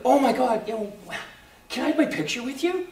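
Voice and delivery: high-pitched imitation of a starstruck fan; excited fan. Fans ask Gary for a picture, mock excited.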